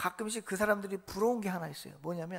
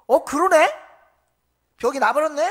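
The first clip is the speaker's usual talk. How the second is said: loud, raised voice of surprise; astonished discovery; 6 semitones higher, 10 dB louder than usual. Acted. Healed man realises his illness is gone, astonished. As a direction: burst out as it suddenly dawns on you.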